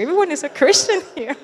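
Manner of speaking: giddy excitement. Retold or imitated. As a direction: blurt it out with giddy delight. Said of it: "And we were like".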